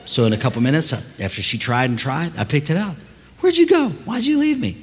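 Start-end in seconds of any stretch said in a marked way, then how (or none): 3.4-4.8 high-pitched child's plaintive demand, imitated; indignant daughter. Left-behind daughter phones dad, high mock complaint. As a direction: put on an aggrieved kid's high voice.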